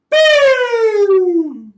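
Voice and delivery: long, loud, high sung boop; sung high note for the kids; high held boop. Shown as a demonstration. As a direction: slide way up and hold it. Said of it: "make that sound just like Mister V".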